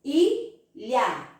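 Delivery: "Ilha" in two separate beats; two mouth openings. Shown as a demonstration. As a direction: pause between the vowel and last syllable of "Ilha". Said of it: "só abre a boca duas vezes".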